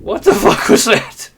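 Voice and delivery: high, loud, slow startled cry with laughter; genuinely alarmed. Real, not acted. Player startled by something in the game, really alarmed. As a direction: react in real alarm, voice jumping high, nervous laugh breaking in. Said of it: "That was, like, genuinely alarming".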